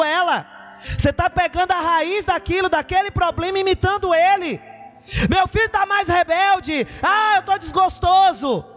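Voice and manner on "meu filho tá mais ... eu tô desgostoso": mock complaint. Voicing a discouraged church member.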